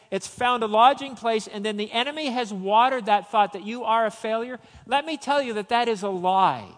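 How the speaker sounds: fiery preacher lifting the room; preaching at full force. Acted. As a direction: preach it out with conviction, building up.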